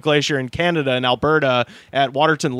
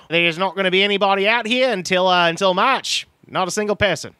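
put-on Australian accent; 3 semitones higher than usual. Comic telling friend how a creepy Australian talks, Australian accent. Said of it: "an Australian just guy just being like".

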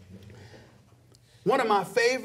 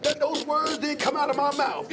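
laughing disbelief; 10 semitones higher than usual. Retold or imitated. Host reacting to [name] saying his words, laughing. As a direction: crack up in mock outrage. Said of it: "And I'm like".

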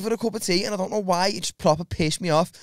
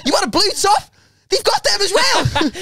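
mock sales pitch; 13 semitones higher, 5 dB louder than usual.

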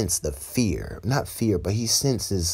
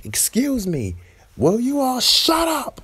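high, drawn-out yell over a crowd; shouting for silence; 4 dB louder than usual. Retold or imitated. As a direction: yell it high, stretching the last words. Class president yells over panicking crowd.

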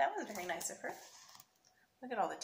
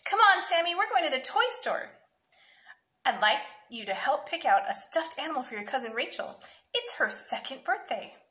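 put-on louder, lifted invitation from a mother to her child; bright-eyed mom announcing an outing; lightly acted storybook mother, raised pitch; lightly voiced mother; 5 semitones higher, 8 dB louder than usual. A narrator's reading.

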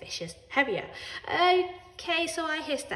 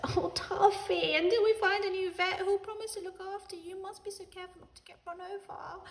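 tearful fretting; 11 semitones higher than usual. A narrator's reading. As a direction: fret over a beloved pet. Tearful Ellie hugs cat's cage.

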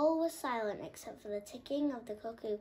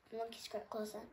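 usual speaking voice

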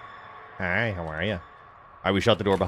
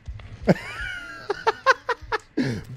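high-pitched drawn-out cackle; real squealing laugh at the game. Real, not acted. Gamer reacting to a mishap, high laughter.

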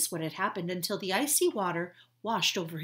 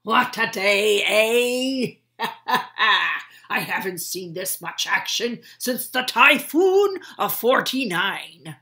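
loud, hearty sea captain with a laugh; captain laughing with his crew after rescue, loud hearty; jolly old captain; 14 dB louder than usual. Acted.

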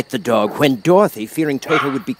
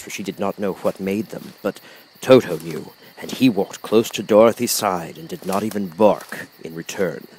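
ordinary delivery, nothing marked

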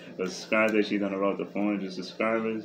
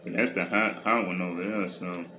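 chewing chips while speaking; talking while chewing. Real, not acted. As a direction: mouth partly full of food. Seen 0: Woman chews chips throughout; unclear whether she or the man speaks.

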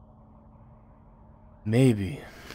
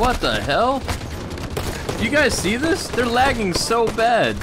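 raging gamer; 9 semitones higher, slower than usual. Real, not acted. Angry at lagging enemies.

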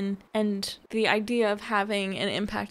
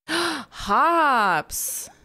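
gasp, then say it higher and louder, thrilled; a sudden delighted find; excited gasp; 6 semitones higher, 5 dB louder than usual. Real, not acted. Gasps on finding hops.

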